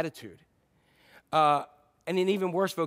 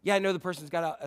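ordinary delivery

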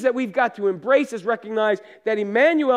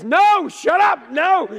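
high, loud frightened no; a scared little girl shouting down the guide; imitated child's panicked shout, much higher and louder; 11 semitones higher, 8 dB louder, much slower than usual. Scared little girl yells again.

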